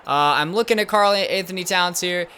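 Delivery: normal talking voice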